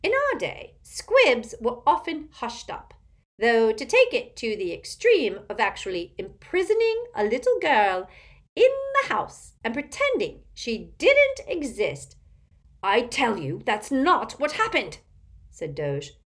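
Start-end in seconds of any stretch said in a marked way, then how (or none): 0.0-12.2 high, swooping busybody character voice; gossipy imperious old aunt. A narrator's reading. Aunt quizzing Elphias about the biography, eager and overbearing. 12.8-15.0 high, loud insistence; indignant protest. A narrator's reading. Doge contradicting the aunt, loud and indignant.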